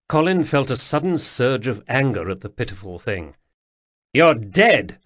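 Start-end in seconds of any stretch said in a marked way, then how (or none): 4.1-5.0 angry, louder snap; snapped. A narrator's reading. Colin snapping at the pitiful ghost in anger. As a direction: bite the words off in fury. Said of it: "he snapped".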